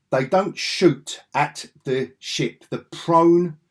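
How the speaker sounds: slow, deliberate disbelief; slow emphasis. Real, not acted. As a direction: spell it out slowly.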